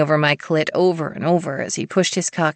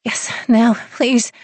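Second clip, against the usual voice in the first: higher, pleading; breathy begging; 7 semitones higher, slower than usual. A narrator's reading. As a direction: beg him eagerly. Heroine begging.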